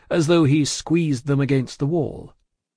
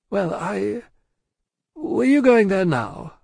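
high, slow, careful question; stall, then ask delicately; hesitant; 8 semitones higher, slower than usual. A narrator's reading. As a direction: discreet banker feeling his way. Lorry hesitates before objecting.

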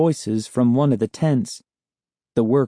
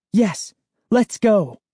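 eager; 10 semitones higher, slower than usual. Acted. Sari eagerly agrees.

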